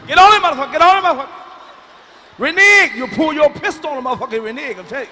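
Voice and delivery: high, very loud shouts, easing at the end; rowdy card-table shouting. Acted. Card player calling out a renege, loud rowdy shouting. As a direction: holler the calls over the card table.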